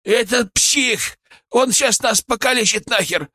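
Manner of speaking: high, alarmed cry; panicked shout. A narrator's reading. Козёл warns his friend about the psycho, panicked shout. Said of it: "крикнул козёл".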